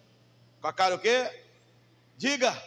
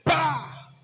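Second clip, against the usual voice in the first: sharp loud bang sound, pitched up; gunshot; 6 semitones higher, 6 dB louder than usual. Retold or imitated. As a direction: bark out a gunshot noise. Gunshot in traffic story.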